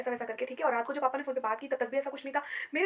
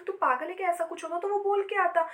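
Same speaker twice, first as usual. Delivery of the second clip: higher-pitched, incredulous retort; mock scolding; 6 semitones higher, 4 dB louder than usual. Father dismissing daughter's hope of a surprise, incredulous. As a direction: retell a dismissive "are you crazy", pitch lifted.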